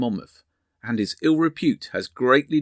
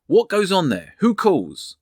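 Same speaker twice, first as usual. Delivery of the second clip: higher, louder call from down the street; 6 semitones higher, 4 dB louder, slower than usual. A narrator's reading. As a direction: hail a disturbance from a distance. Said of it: "a voice called".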